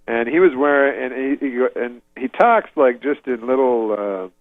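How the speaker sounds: ordinary talk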